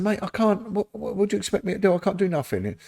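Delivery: exasperated pleading. Retold or imitated. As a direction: play up the helpless protest to a friend. Pleading with angry fishmonger mate.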